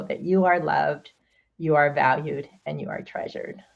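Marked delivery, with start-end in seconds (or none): 2.7-3.6 whispered through tears. Real, not acted. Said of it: "I'm gonna get emotional".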